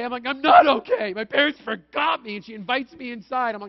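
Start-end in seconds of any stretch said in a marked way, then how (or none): 0.3-2.4 put-on louder, higher distressed child; child's wailing reply. Lost boy tells the kind lady his parents forgot him, upset and loud. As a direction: blurt it out like a lost crying kid.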